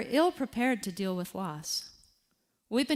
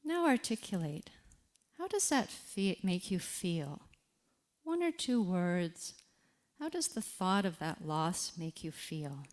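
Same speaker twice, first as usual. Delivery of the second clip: softer, unhurried reflection prompts with long pauses; guided reflection; 4 dB quieter than usual. Acted. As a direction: guide the listener gently through the moment. Leads a reflection exercise.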